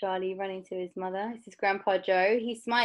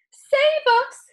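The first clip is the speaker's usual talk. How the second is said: very high, loud panicked shriek; Grandma Josephine crying out; 11 dB louder than usual. A narrator's reading. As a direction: shriek for help. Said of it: "cried Grandma Josephine".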